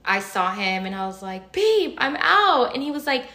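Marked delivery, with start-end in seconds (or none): 1.5-2.7 high, loud, stretched-out call; relieved announcement. Retold or imitated. She spots her partner after exiting, high relieved call. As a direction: call it out slowly in retold relief.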